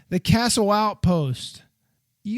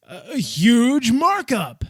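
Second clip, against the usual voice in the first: greedy designers; 6 semitones higher, 5 dB louder, much slower than usual. Retold or imitated. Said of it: "Designers are like".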